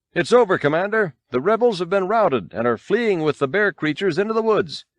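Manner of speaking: high, eager pilot calling in a victory; breathless good news to his commander; excited report. A narrator's reading. Walker pilot reporting victory to the commander, excited. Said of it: "waving excitedly".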